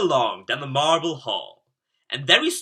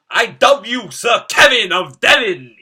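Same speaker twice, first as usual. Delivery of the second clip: loud, higher, grand royal voice; regal proclamation; 8 semitones higher, 11 dB louder than usual. Acted. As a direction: proclaim it like a king knighting him. King dubs Kevin a knight.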